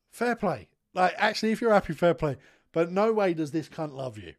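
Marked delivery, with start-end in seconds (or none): none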